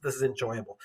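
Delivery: ordinary talk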